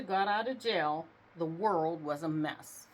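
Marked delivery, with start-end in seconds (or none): none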